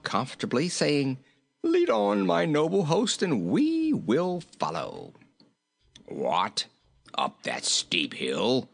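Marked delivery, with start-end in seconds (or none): 1.6-5.1 high voice slowing at the end; merry, stately high character voice; jolly, grand king. A narrator's reading. Jolly king addresses his host. 6.0-8.7 low, slow grumpy voice; cross goat's low growl; gruff, balking goat. A narrator's reading. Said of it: "cried the goat".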